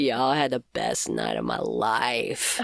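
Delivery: low, slow Texan drawl; lazy low cowboy purr; Matthew McConaughey. Acted. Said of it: "that sounded kinda like Matthew McConaughey".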